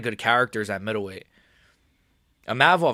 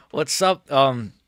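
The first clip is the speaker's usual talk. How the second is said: ordinary talk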